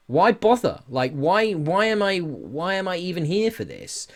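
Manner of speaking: normal talking voice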